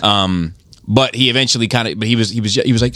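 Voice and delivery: ordinary talk, nothing marked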